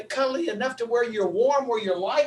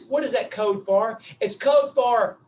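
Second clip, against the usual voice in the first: ordinary talk, nothing marked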